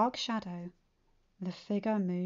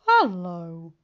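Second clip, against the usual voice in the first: smirking greeting; 2 semitones lower, 6 dB louder, much slower than usual. A narrator's reading. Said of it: "smirked and said".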